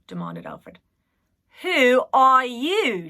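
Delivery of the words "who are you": cheeky echoed question. A narrator's reading. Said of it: "she asked back".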